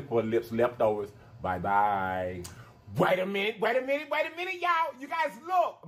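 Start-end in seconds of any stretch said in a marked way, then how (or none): 3.0-5.9 excited callback. Real, not acted. Calls viewers back again.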